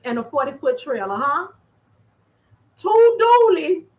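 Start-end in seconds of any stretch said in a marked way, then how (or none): 2.8-3.9 "Two dualies" much higher, louder and slow; stress on two dualies. Real, not acted. Dispatcher stressing the trucks she lost, high and slow.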